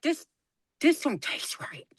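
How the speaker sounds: hushed whisper, no voice; a secretive whispered complaint. Retold or imitated. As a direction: lean in and whisper it suspiciously. Mom tasting beer, hushed.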